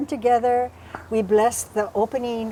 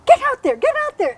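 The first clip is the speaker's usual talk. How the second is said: high-pitched, punchy mock command; acted cry, starting loud; urgent ordering away; 14 semitones higher, 5 dB louder, faster than usual. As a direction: shoo them off in a high voice. Acts out ordering intruders away.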